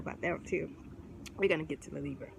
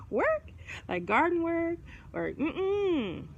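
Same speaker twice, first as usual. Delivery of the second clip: mock disbelief; 9 semitones higher, slower than usual.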